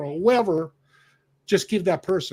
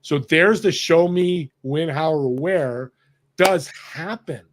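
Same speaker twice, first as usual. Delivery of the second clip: slow emphasis; 3 dB louder, slower than usual. Real, not acted. Emphatic point at the story's end.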